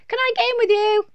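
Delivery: put-on very high, loud toddler squeal; littlest child. A narrator's reading. Little one begs to join Mum's bath, high squeal. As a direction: squeak it eagerly at full voice. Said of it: "asked the little one".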